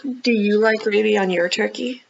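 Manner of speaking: plain reading voice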